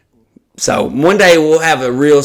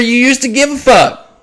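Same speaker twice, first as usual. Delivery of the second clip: usual speaking voice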